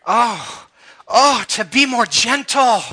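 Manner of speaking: high, loud, drawn-out pleading; yearning prayer at full voice; impassioned prayer. Acted. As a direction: cry out slowly in longing. Acting out an impassioned plea.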